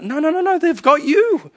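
high, insistent correction; emphatic no. Real, not acted. Pastor correcting the congregation, insistent and high. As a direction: cut them off, pitch jumping up.

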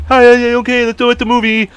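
loud, high goofy agreement; over-the-top put-on character. Playing along with her plans, high put-on voice. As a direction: ham up the pretend voice, pitched high. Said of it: "I was like".

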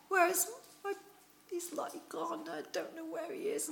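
tearful pleading. Acted. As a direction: ask through tears for the missing body. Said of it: "Woman, why are you weeping?".

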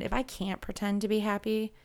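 usual speaking voice

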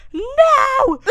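high, loud wail; mimicked shrill child protest; refusing toddler imitation. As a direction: shout like a tantruming toddler.

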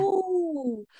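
high, drawn-out wondering "oh"; mock-awestruck high coo; awe and wonder. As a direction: coo like a wide-eyed cartoon creature. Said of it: "in awe and wonder".

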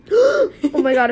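loud, drawn-out gasp of recollection; gasp, then exclaim slowly and loudly; real surprise. Real, not acted. Suddenly reminded of forgotten story.

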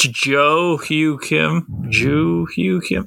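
slowly spelling out the name; drawn-out, deliberate pronunciation; slow, careful name. Shown as a demonstration. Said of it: "his name's not Tom".

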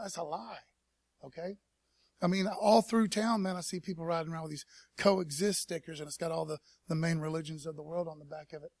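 normal talking voice

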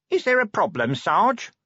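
high, worried underling's question; anxious. A narrator's reading. Corporal asking sergeant if there's trouble, anxious. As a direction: ask nervously, pitched well up. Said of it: "said Nobby anxiously".

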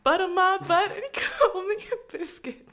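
loud, high hammed-up country western; country western accent. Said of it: "your country western was amazing".

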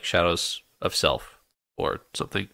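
usual speaking voice

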